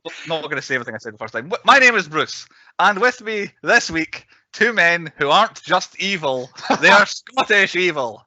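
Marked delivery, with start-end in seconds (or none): 1.5-8.2 show host intro. Acted.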